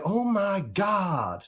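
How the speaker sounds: very slow drawn-out American "Oh my God"; old American accent. Retold or imitated. Recalling how he used to talk, slow American drawl. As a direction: stretch it out in a soft American drawl. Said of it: "back in the day, I used to go".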